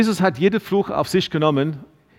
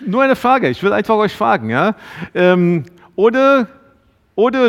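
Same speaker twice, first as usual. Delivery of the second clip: louder calling out; 5 semitones higher, 5 dB louder than usual. Real, not acted. Preacher calling out to congregation.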